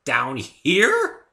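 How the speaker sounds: high and loud astonishment; stunned, pointing skyward; incredulous. Acted. Dave to Fluttershy, high and loudly amazed.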